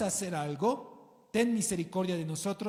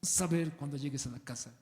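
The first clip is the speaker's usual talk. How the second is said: put-on low, quiet threatening aside to a child; hint of a stern parent; mock parent warning; 5 semitones lower, 3 dB quieter, faster than usual. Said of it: "Haz la clásica".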